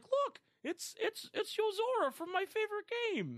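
high, excited toy dinosaur voice; Rex voice. Retold or imitated. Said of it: "but Rex is like".

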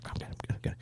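imitated whispered reassurance, repeated; hushed brush-off. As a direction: brush off the worry under your breath. Brushing off wife's concern.

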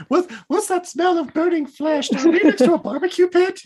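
high, loud character voice; mock blind character. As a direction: play a clueless blind villager.

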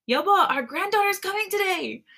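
high, joyful calling out; excited yell. A narrator's reading. Oma yelling the news to appa, excited. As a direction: call it out high to someone farther away. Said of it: "then yells out to my appa".

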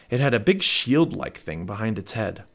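usual speaking voice

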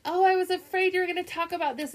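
high, quick mock-nervous gush; high, quick mockery of a wife faking dread; mock Robin. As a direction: rattle it off high and falsely anxious. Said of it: "she's like".

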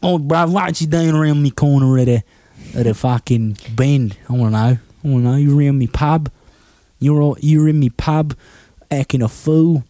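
put-on Cockney accent. Co-host playing a Londoner confronting someone in his pub, hammed-up Cockney accent. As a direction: go full mock Cockney geezer. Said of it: "A Cockney accent would be ideal".

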